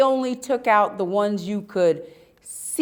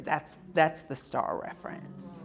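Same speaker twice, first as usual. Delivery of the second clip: whispered aside; 4 semitones lower, 11 dB quieter than usual. Real, not acted. Explaining aside about dad.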